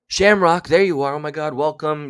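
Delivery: loud, high call of welcome; excited greeting. Real, not acted. Host greeting a latecomer in chat, excited. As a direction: burst out on spotting a friend. Greets a late arrival mid-story.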